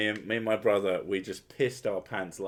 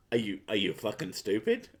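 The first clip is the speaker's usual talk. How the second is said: ordinary talk, nothing marked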